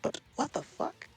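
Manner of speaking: hammed-up high indignation; mock-polite outrage. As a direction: play the scandalised polite child. Playing affronted reply to mother.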